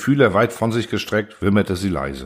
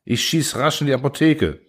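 urgent call; 4 semitones higher than usual. A narrator's reading. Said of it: "rief da das gute Seepferd".

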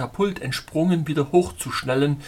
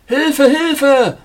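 high, loud cry for help; fervent scream; 13 semitones higher, 12 dB louder than usual. A narrator's reading. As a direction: scream desperately for rescue. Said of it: "schrie er inbrünstig".

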